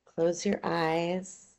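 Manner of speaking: ordinary talk, nothing marked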